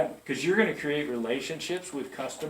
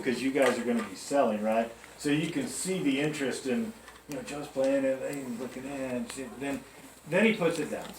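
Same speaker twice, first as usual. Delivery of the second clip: ordinary talk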